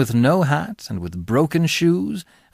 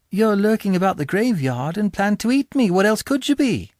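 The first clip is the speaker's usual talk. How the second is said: put-on higher small boy voice, earnest; higher child voice reasoning with a stranger; frightened boy; 7 semitones higher than usual. A narrator's reading. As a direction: plead the obvious like a scared child. Boy answers the convict.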